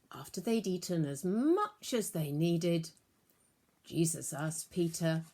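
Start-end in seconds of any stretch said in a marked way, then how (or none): none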